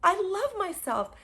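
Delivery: high, loud gushing; giddy delight; elated self-love. Retold or imitated. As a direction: overflow with joy. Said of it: "I was like".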